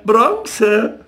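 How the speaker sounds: high jeer dropping at the end; spiteful jeer. A narrator's reading. Boys catching crayfish jeering at Jakob, spiteful. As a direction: finish the taunt with a nasty fall. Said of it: "riefen sie gehässig".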